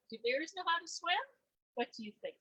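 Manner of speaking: ordinary delivery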